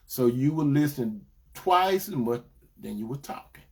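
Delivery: normal talking voice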